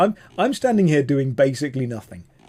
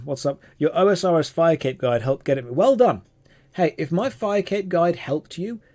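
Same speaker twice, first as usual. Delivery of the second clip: ordinary talk, nothing marked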